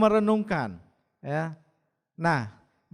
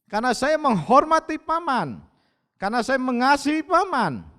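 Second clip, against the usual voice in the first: higher, insistent retold declaration; emphatic declaration; 5 semitones higher, 5 dB louder than usual. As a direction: declare it earnestly, pitch raised on each sentence. Retells earnest declaration to uncle. (pernyataan tegas dengan nada meninggi)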